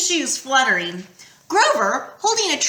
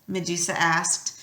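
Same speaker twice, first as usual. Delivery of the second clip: usual speaking voice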